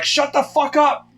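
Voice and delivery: hammed-up cranky mother snapping at her son; annoyed mother. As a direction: imitate a mom woken at night. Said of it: "my mother woke up and she was like".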